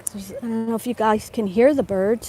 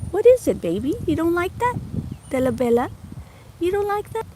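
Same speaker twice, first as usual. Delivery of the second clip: pet talk; 8 semitones higher than usual. Real, not acted. Comforting her dog Della.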